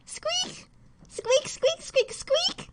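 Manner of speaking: high thin squeaks like a mouse. Pretend. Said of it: "Good squeak".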